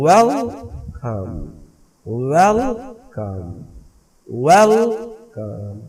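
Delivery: Welcome slowly, with a long वेल and weak कम; slow syllable-split welcome, front-stressed; stress on first syllable. Shown as a demonstration. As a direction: stretch वेल, let कम fall away. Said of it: "इसी तरह ये देखिए".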